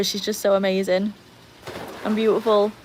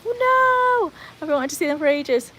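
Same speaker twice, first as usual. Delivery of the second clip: dismayed outcry; 8 semitones higher than usual. Retold or imitated. Streamer retelling reaction to colleague's free tickets, dismayed outcry. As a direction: wail it high, then ease down.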